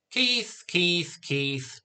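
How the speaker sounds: name repeated, stepping down from high; mock the name, starting high and falling each time; exasperated echo. A narrator's reading. Exasperated mother echoes the name.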